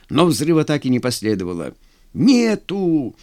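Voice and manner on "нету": raised, high-pitched cry; shouted. A narrator's reading. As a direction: call out the bad news. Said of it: "крикнул он". (выкрикнуть повыше)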